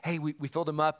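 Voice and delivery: ordinary delivery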